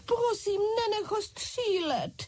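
very high-pitched pleading; crying out. A narrator's reading. French doll begs that the soldier not shoot, high-pitched cry. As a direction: beg in a high little doll voice. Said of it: "křičela malá Francouzka".

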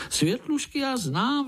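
very high, eager little voice; excited exclamation. A narrator's reading. Young spider interrupts uncle's tale, excited. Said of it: "vykřikl Pája".

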